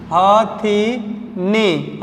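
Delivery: हथिनी drawn out, short ha, thi, ni; slow correct pronunciation. Shown as a demonstration. Teacher correcting हाथनी, slowly. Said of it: "हाथनी नहीं होता है".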